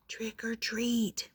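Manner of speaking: slow, hushed little call; very soft. A narrator's reading. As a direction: say it very softly, drawn out. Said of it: "he said very softly".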